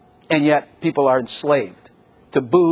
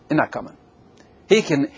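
usual speaking voice

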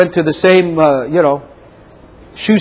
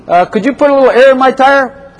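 high, meek little customer voice; 8 semitones higher than usual. Acted. Preacher voicing a driver asking the mechanic, high and meek. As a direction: play the clueless driver asking sweetly.

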